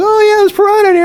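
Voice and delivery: high, quick taunting imitation; retold mocking reply. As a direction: scoff it fast and high. Retells mocking reply about piranha.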